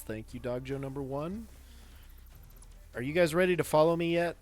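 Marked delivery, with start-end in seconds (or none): 2.9-4.4 calling out. Acted. Calling creatures to follow.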